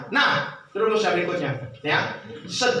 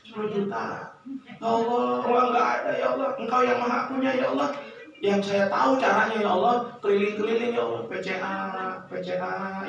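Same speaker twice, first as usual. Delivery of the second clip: natural chat with God; 8 semitones lower, 4 dB quieter than usual. Shown as a demonstration. Preacher demonstrating a natural, intimate prayer, soft and unhurried. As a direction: talk to Allah softly like to a friend.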